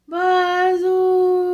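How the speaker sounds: the wind's voice, high and loud; eerie moaning wind sound; howling wind. A narrator's reading. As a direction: howl it like a storm wind through the chimney. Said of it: "it went".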